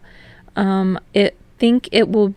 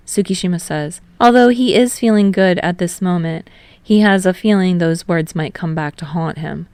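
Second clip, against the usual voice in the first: ordinary delivery, nothing marked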